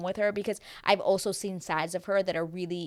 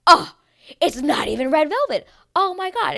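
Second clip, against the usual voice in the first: loud, high hammed-up dismay; exaggerated loud, whiny-high complaint; mock outrage; 10 semitones higher, 9 dB louder than usual. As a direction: act out a tantrum over cake, pitched up. Acted cake tantrum.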